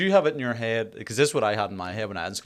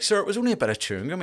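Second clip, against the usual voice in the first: high, fast mock-innocent protest; mock innocent pupil; 10 semitones higher, faster than usual. Retelling pleading with the history teacher, mock-innocent high voice. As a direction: pitch it up and rush it like a cheeky kid.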